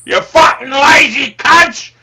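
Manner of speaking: loud ranting Cockney trader voice, swearing at the clients; shouting Cockney character. Acted. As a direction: bellow the price list in a Cockney accent. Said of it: "getting a bit carried away there with the character".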